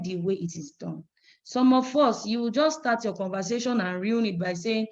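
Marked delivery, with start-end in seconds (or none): none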